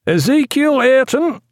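high, slow roll-call cry; cried out. A narrator's reading. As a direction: call the name out high across the deck. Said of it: "cried Mister Adams".

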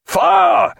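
high piercing fire order; shouted command. A narrator's reading. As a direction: yell it on the upward roll. Captain giving fire order.

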